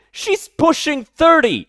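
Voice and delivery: high, slow, loud correction; incredulous yell. Real, not acted. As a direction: shout the correction. Correcting the age claim.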